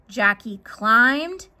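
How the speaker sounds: plain reading voice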